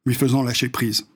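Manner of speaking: ordinary delivery, nothing marked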